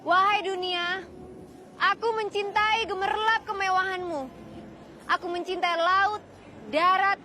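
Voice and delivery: hammy stage actor. Shown as a demonstration. (deklamasi teatrikal yang berlebihan)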